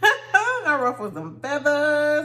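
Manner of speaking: laughing while saying it, slow. Real, not acted. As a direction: giggle through the confession. Laughing admission.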